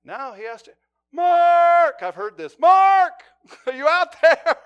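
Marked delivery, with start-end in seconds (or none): 1.1-2.0 high, loud shout of a name; calling out. Retold or imitated. Barricaded man calling the negotiator outside, loud shout. 2.6-4.6 calling out. Retold or imitated. Man calls out to negotiator.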